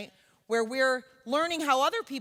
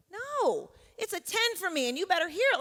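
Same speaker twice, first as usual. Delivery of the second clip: emphatic protest; 7 semitones higher than usual. Acted. Insisting the pain is real.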